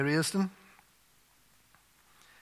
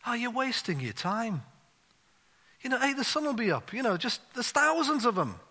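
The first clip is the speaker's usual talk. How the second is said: a hint of a weary old man chiding a child; gently dismissive old man, pitched up; 9 semitones higher than usual. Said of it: "he says to the youngster".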